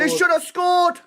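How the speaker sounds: loud, high, insistent exclamation; insistent shout. Real, not acted. Fan insisting on the missed chance, insistent shout. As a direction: yell it to hammer the point. Repeats outburst over the other voice.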